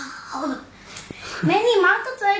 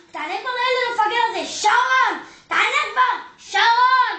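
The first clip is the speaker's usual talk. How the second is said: yelling for Sharon; 3 semitones higher than usual. Acted. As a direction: scream the name across the flat. Mother yells to Sharon indoors.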